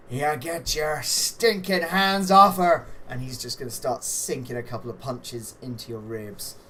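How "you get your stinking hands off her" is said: loud, high, slow growing yell; wrestler's angry threat. Acted. Game master as the bleeding wrestler warning the player, angry yell. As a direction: roar it slowly at the opponent. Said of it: "He just goes".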